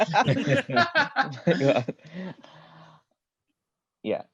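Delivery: dissolving into laughter; breaking up at the lips joke; laughing. Real, not acted. Cracking up at joke.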